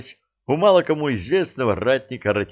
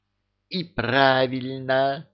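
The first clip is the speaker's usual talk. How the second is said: put-on joyful old man; 4 semitones higher, slower than usual. A narrator's reading. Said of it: "радостно подпрыгнул старик".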